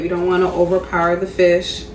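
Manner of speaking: ordinary delivery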